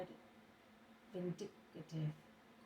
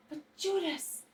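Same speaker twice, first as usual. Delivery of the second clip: high, loud reproach of the name; Jesus shouting at Judas in alarm; sharp shout; 10 semitones higher, 10 dB louder than usual. A narrator's reading. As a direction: cut him off with a shout. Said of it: "shouts Jesus".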